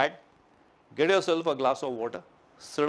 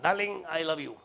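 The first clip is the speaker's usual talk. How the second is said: tender darling; 7 dB quieter than usual. Pretend. Hugging wife, tender words.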